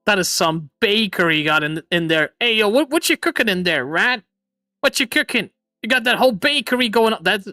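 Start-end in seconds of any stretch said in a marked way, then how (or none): none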